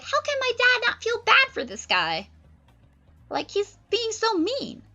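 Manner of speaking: put-on very high, whiny teen girl; Miranda's voice. Miranda complains about her dad, high and whiny. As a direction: whine like a teenage daughter. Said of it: "Miranda's like".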